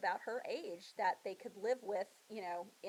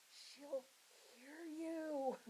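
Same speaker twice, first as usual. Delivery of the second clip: hushed warning; 7 semitones higher, 7 dB quieter, much slower than usual. Acted. Sick cousin warns hushed.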